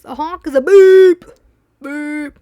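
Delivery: a loud high "beep, beep" car horn; imitated honk. Shown as a demonstration. Explaining honks to the driver, loud beeping horn imitation. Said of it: "A honk is just a noise".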